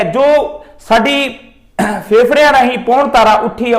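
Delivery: normal talking voice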